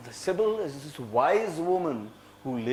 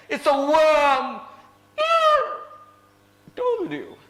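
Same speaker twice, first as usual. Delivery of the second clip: high, slow, louder frightened telling; spooked; 10 semitones higher, 4 dB louder than usual. Acted. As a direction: drag it out in dread. Character confirms the monster.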